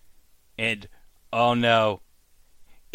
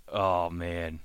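ordinary talk

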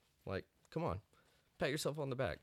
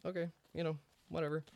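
dismissive retold reaction; 7 semitones higher, faster than usual. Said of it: "I was like".

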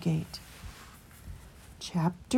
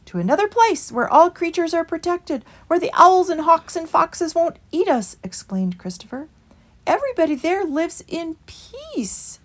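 higher mild voice of a small creature; meek small creature pleading for the way; Christopher; 10 semitones higher, 5 dB louder than usual. A narrator's reading. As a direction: lift into Christopher's gentle, higher voice. Christopher explains.

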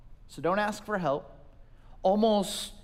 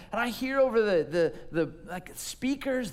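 normal talking voice

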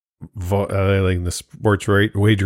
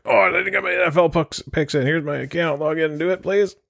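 pleading friend; 9 semitones higher than usual. Retold or imitated. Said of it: "calling your buddy".

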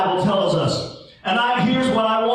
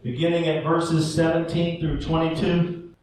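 scripture reading; 8 semitones lower, 3 dB quieter than usual. Acted. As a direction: read the Bible passage to the congregation. Opening scripture reading, measured.

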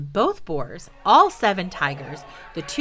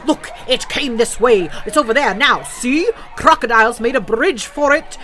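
loud, raised boar voice; wild boar scream; 5 semitones higher, 7 dB louder than usual. Acted. Wild boar screaming to the crowd, loud and irate. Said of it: "he heard a wild boar scream".

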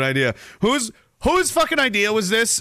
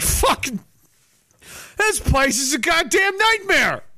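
exasperated mock complaint; 8 semitones higher, slower than usual. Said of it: "You're like".

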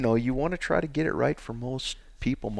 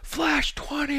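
high-pitched, held-back mock scream; retold scream; 4 dB quieter, much slower than usual. Retelling yelling an answer in the barn, strained mock scream. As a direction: scream it in falsetto without full volume. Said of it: "I was screaming in my barn".

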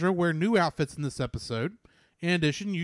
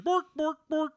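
high, loud Bork, bork, bork in a comic chef voice; mock Swedish Chef gibberish; 9 semitones higher, 4 dB louder than usual. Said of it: "sound like the Swedish chef from the Muppets".

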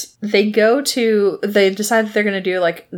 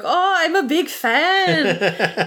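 starstruck fan; 8 semitones higher, much slower than usual. Retold or imitated. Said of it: "he's like".

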